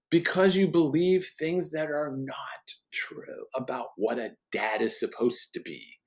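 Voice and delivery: ordinary talk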